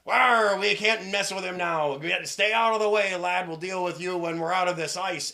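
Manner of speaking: hammed-up seafaring shout; pirate captain. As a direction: dismiss the lad like a busy skipper. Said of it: "And the captain's like".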